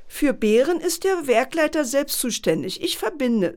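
schnippisch, etwas höher gesprochen; spitz. Acted. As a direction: der Sekretärin einen spitzen, herablassenden Ton geben. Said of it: "sagte die Sekretärin spitz". (Sekretärin fertigt den Pförtner am Telefon spitz ab)

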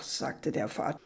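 ordinary delivery

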